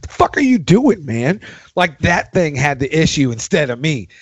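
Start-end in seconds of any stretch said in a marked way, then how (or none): 0.0-1.4 high angry jab at the drum pad; mock-irritated, pitched high; cursing at the pad. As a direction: snap at the gear.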